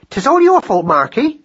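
high, loud accusation; blaming wife. A narrator's reading. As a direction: turn the scolding on the husband.

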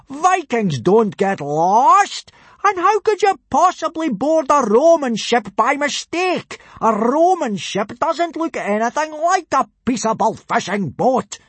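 loud, high-pitched furious tirade; incredulous shouting at full pitch; outraged booming. A narrator's reading. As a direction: bellow it at a hapless recruit. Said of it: "boomed Gobber".

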